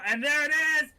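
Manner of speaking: ordinary delivery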